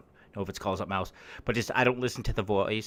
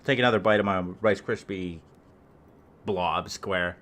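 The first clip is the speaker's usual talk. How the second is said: mouth full; 3 semitones lower than usual. Real, not acted. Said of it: "and chewing on my".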